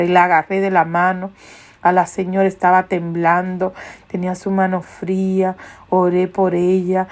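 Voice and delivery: normal talking voice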